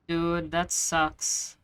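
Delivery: ordinary delivery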